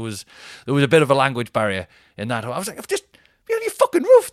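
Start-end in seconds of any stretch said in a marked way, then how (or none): 2.8-4.3 very high, loud shriek of outrage; exasperated mock shout. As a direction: explode at hotel staff in falsetto. Retold furious complaint to staff.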